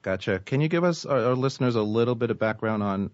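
ordinary talk

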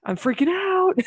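high, loud playful panic; mock freak-out. Writer seeing own story on screen, high mock freak-out. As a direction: squeal it way up high, half laughing.

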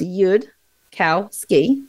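"Yud, Cow, Ski" broken into separate syllables with pauses. Shown as a demonstration. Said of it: "I break up the syllables".

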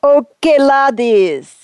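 put-on a second, lighter go at the wrong Scottish-ish accent; fake accent again. Saint's second attempt at an American voice, still a wrong accent. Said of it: "try it again".

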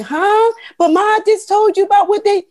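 high startled protest to mom; shocked protest. Retold or imitated. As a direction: squeak it out in disbelief. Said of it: "I was like".

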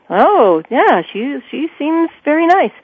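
high, haltingly polite mock approval; acted-out insincere politeness, climbing high; feigned approval. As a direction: pretend to approve, pitch lifting sweetly. Feigning approval after jealous first sight.

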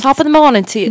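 hammed-up Irish lilt; attempted Irish accent. Shown as a demonstration. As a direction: belt out a stage Irish accent. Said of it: "No, that's, that's Irish".

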